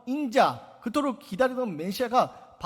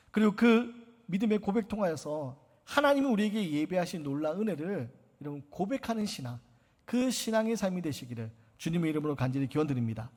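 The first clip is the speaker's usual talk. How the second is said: ordinary talk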